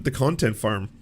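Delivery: ordinary talk, nothing marked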